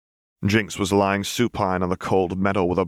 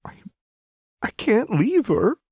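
teary. Acted. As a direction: hold back tears as you refuse. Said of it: "teary-eyed".

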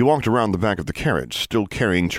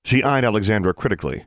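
ordinary delivery, nothing marked

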